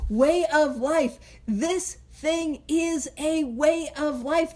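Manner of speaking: slow and weighty on Way of life; emphasized. Shown as a demonstration. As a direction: lean on Way of life, drawn out. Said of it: "I emphasize, let's highlight, let's underline".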